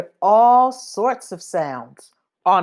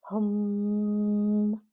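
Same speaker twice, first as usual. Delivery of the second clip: a quiet, drawn-out hum; soft car hum; 2 semitones higher, 8 dB quieter than usual. Shown as a demonstration. Reading to a child, humming like a quiet new car. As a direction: let the word fade like a new car passing. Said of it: "They make only a soft".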